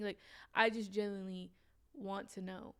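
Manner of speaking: ordinary delivery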